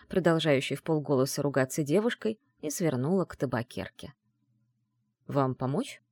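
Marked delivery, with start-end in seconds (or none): 5.3-6.0 politely offered help, a little low; softly courteous male character. Acted. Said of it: "учтиво спросил привлекательный мужчина".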